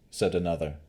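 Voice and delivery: plain reading voice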